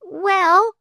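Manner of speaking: high, loud, stalling "well"; floundering. A narrator's reading. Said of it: "floundered Flame".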